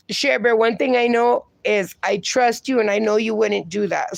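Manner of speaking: ordinary delivery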